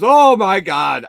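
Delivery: high, loud, slow oh my God; hammed-up shocked old lady; mock dismay. Old lady forgets her glasses.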